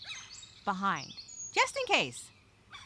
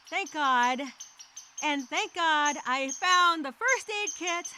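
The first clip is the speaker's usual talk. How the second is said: ordinary talk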